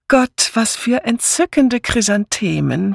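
high, fluty gushing; fluted society admiration; fluting. A narrator's reading. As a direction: coo it sweetly, pitched high. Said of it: "flötete Misses Easterbrook".

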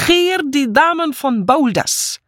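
high, slow trumpeted announcement; blaring maid's proclamation; trumpeting. A narrator's reading. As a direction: trumpet the arrival, pitched very high. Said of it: "und trompetete".

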